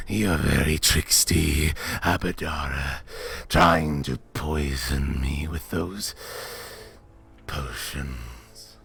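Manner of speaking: a lazy, menacing beast savouring its prey; Manticore voice. Acted. Manticore greets Abadara at last, low slow menacing monster voice.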